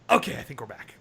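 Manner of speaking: normal talking voice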